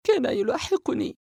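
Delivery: put-on a shaken girl blurting it out, high-pitched; frightened young girl. Sara voiced by narrator. (صوت فتاة خائفة بنبرة عالية)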